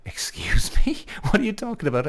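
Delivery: high, breathy laughing "excuse me", mock shock; retelling how he answered his girlfriend, laughing in disbelief; disbelieving laugh. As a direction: act stunned, half-laughing in disbelief. Retold girlfriend's accusation, disbelief.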